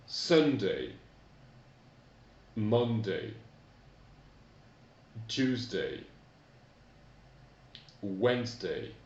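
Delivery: each weekday said clearly in English, falling; careful Sunday to Saturday; teacher modelling for repetition; model English pronunciation. Shown as a demonstration. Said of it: "on reprend tout ça".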